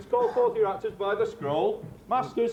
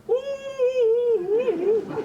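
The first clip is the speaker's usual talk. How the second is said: gentle roar; 14 semitones higher than usual. Acted. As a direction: coo the roar like a nightingale. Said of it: "roar you as gently as any sucking dove".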